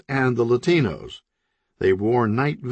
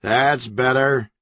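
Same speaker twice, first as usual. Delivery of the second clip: shout; 3 semitones higher, 3 dB louder, slower than usual. Acted. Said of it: "shouted Zaragoza".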